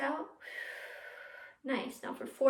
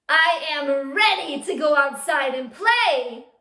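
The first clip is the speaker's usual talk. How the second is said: excited; 3 semitones higher, 12 dB louder, slower than usual. Shown as a demonstration. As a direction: show them you can't wait to play. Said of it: "show me that you're excited".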